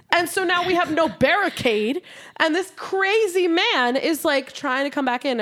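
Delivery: building fear in the retelling; rising alarm. Real, not acted. Retelling a scary break-in.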